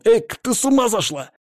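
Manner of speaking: high startled outcry; shocked protest. Acted. Shocked at being bitten.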